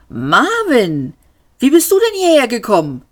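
high, quick, amazed question; astonished. A narrator's reading. Man asking his robot how it got here, astonished. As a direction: blurt it out in amazement. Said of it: "wirbelte erstaunt herum".

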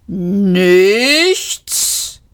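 "Nichts" stretched long, swelling and rising; war machine roaring "Nichts", climbing at the end; roaring disbelief. A narrator's reading. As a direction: bellow "Nichts" as an incredulous drawn-out question. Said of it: "röhrte die Kampfmaschine".

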